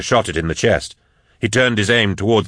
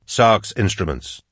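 ordinary delivery, nothing marked

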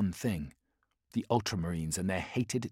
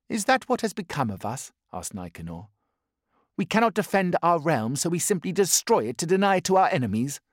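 higher and louder, indignant; bitter protest; 9 semitones higher, 6 dB louder than usual. Acted. Nicanor bitterly questioning their orders, indignant. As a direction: challenge the order with scorn.

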